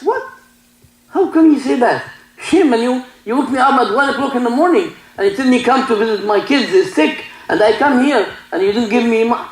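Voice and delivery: indignant demand for his fee; angry doctor. Retold or imitated. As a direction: scold her like a greedy doctor demanding pay.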